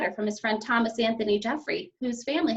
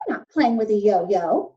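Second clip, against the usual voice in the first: sharp; 7 dB louder than usual. A narrator's reading. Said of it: "Missus Lambchop said sharply".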